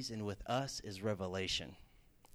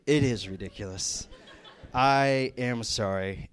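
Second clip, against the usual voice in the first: sheepish laughing admission; 7 dB louder, slower than usual. Retold or imitated. Student admits plagiarism, caught out.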